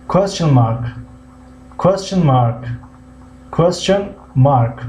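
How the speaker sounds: "Question mark" said slowly, stressing QUES-tion, falling; English phrase modelled. Shown as a demonstration. Teacher drills "Question mark" slowly for the class. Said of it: "teacher models English phrase".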